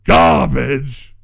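slow, loud hammy villain shout. Acted. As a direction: bellow the insult like a cartoon villain.

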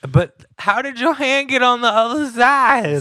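high, loud, laughing disbelief; cracking up in mock amazement; laughing surprise.

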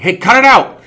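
high, loud yell at pests; loud scolding shout. Retold or imitated. Yelling at the monkeys near his GoPro, loud and high. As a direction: bark it across the room.

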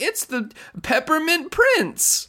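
high, drawn-out gushing reveal; hammed-up, high-pitched proclamation; mock announcement. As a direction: announce it slowly in an exaggerated high voice. Mimics show's needless name announcement.

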